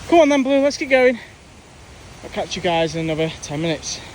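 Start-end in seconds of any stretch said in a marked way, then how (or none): 0.1-1.2 high, loud call to the dog. Real, not acted. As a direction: call the dog along, upbeat. Calling the dog onward.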